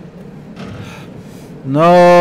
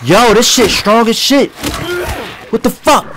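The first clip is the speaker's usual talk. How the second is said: high panicked repeated shouts; panicked yelling; 8 semitones higher than usual. Real, not acted. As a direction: lose it in real panic. Panicking at a horror game monster.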